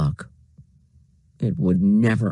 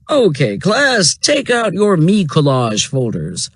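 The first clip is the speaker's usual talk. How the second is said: teacher voice; 5 semitones higher, 3 dB louder than usual. A narrator's reading. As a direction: address the whole class. Teacher Mister Todd.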